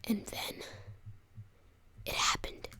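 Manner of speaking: hushed suspense whisper; dramatic whisper. Real, not acted.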